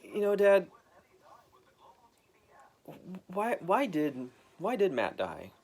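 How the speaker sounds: put-on high, young voice; kid asking. Adopted son asking his dad, high and curious. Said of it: "I was like".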